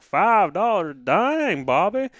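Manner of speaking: mock American accent. Imitating American listeners amazed at the price, mock American accent. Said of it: "American listeners are gonna be like".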